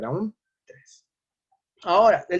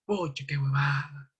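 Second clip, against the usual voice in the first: groggy patient; 4 dB quieter than usual. Retold or imitated. Patient reacts to broken bike.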